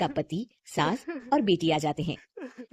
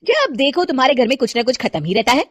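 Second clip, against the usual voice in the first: shouting; 4 semitones higher, 9 dB louder than usual. Acted. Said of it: "चिल्ला क्यों रही हो?".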